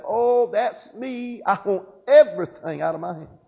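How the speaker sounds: high, drawn-out eager cry; acted-out eager volunteer; eager declaration. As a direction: jump up and claim it, stretching the opening words. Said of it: "jump straight up and say".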